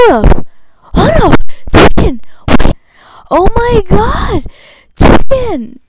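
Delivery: high, loud excited yells; excited shouting. Real, not acted. YouTuber yelling at Chicken in high, loud excitement. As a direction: yell out in sudden excitement.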